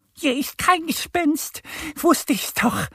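high, squeaky duck, sure of itself; kleine Ente. A narrator's reading. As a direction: say it high as a know-it-all little duck. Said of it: "sagt Annie". (hohe, selbstsichere Entenstimme)

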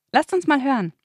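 usual speaking voice

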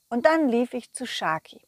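usual speaking voice

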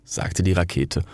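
ordinary delivery

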